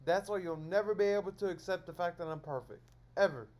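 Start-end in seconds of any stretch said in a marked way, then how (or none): 3.2-3.5 Ever, punched out loud as a closer; emphatic final word. Real, not acted. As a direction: drop Ever like a verdict. Tacking on a final jab.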